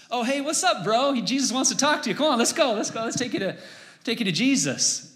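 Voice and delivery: high, quick, eager mock chumminess; changed, friendly crowd. Crowd now urges blind man toward Jesus, eagerly chummy. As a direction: imitate a crowd suddenly eager to help. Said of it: "the tune of the crowd changes".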